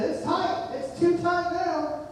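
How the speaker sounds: high-pitched mock protest, last part drawn out; exasperated retort. Nephew to uncle over the overtightened bolt, high exasperated retort.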